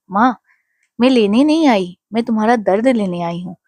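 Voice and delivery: usual speaking voice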